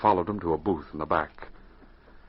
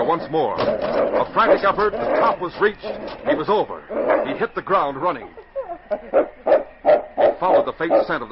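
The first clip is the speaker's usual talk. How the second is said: higher and louder, narration building urgency; rising excitement; 7 semitones higher, 4 dB louder than usual. A narrator's reading. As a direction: drive the story forward as the dog breaks free. Narrator builds the escape scene.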